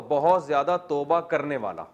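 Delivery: usual speaking voice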